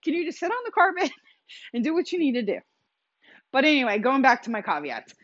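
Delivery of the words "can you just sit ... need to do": high, coaxing voice pleading with a puppy; talking to the puppy. Retold or imitated. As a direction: shoo the dog out of the bath in a high baby-talk voice. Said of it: "And I'm like".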